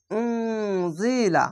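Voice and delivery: উনজিলা slow with held nasal ghunna on the n. Shown as a demonstration. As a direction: stretch উনজিলা, humming through the nose on the n. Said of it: "এখানেও ইকফা গুন্না". (গুন্নাসহ টেনে পড়া উনজিলা)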